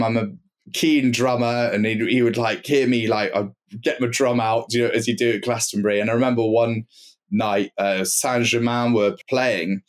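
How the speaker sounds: ordinary talk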